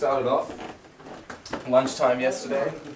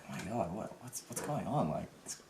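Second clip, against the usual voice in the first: softer disbelieving aside to a friend; hushed aside; 2 semitones lower, 10 dB quieter than usual. Retold or imitated. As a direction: mutter it so she cannot hear. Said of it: "looking at each other like".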